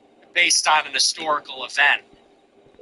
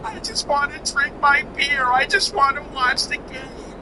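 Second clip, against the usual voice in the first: high whining imitation of a weepy singer, about to cry; whiny mock cry; 9 semitones higher than usual. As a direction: mimic a sobbing loser, high and whimpering. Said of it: "sounding like he's gonna cry".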